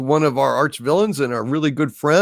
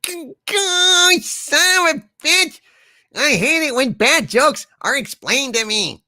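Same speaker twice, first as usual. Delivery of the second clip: high-pitched hammed-up exasperation; squealing mock annoyance; mock outrage; 12 semitones higher than usual. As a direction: play up the fury at a bad joke. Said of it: "that just really pisses me off".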